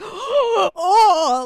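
a real choked-up cry of dismay; upset gasp. Real, not acted. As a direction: gasp like the movie really hurt. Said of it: "What's wrong with you?".

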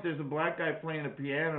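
normal talking voice

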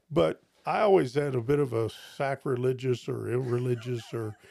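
normal talking voice

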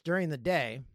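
ordinary talk

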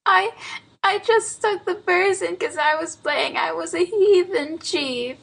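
high, mock-sobbing little boy; sobbing child. A narrator's reading. Boy confesses to his aunt about the burrs, sobbing. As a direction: whimper it out as a small boy. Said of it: "sobbed Lionel Hezekiah".

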